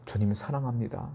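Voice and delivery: very soft, shy confession; meek, hushed reply; hesitant, sheepish. Retold or imitated. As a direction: answer timidly, barely daring to say it. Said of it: "베드로가 쭈뼛쭈뼛하는 마음으로".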